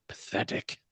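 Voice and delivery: breathy contemptuous hiss; sneering whisper. Acted. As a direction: scorn it under your breath. King sneers.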